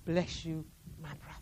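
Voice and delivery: soft, low and sincere; tender blessing. Acted. Survivor blessing her former guard, tender and soft. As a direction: let it fade out gently.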